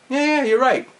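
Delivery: high-pitched eager yes-man; mock-compliant high voice; retold henchman. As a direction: agree instantly, like an obedient minion. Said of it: "the guy's like".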